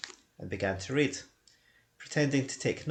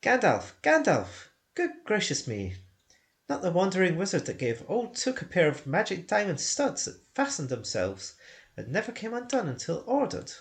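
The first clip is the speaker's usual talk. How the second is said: astonished hobbit; 6 semitones higher than usual. Acted. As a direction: gasp out the name as it comes back. Bilbo suddenly remembering.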